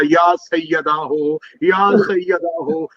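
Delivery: drawn-out, slow calling of the name; slow mournful call. Acted. Husain calling his mother three times from Karbala's dust, slow and drawn out. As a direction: stretch each word like a call into the dust. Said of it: "तीन मरतबा का".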